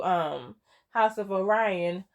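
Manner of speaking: normal talking voice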